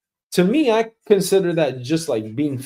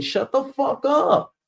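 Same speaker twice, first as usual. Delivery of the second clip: much higher, slow, exasperated; angry retort; 9 semitones higher, 3 dB quieter, slower than usual. Acted. Answering the boss's check-in, a high slow angry retort. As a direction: spit it back at him.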